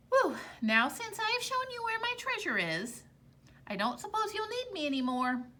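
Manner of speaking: high sly leprechaun voice. Acted. Leprechaun fishing to be let go, high sly voice. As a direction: sound innocent and sly.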